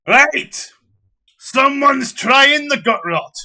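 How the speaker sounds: loud, high call across the tavern; barkeep's shout. Acted. Barkeep rings the bell and shouts to the whole tavern. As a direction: bellow it out to the whole room. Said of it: "and he goes".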